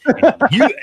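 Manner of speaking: sudden loud, high shout at her; angry shout. Retold or imitated. Mechanic yelling back at rude customer, shouting. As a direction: blow up and shout the words.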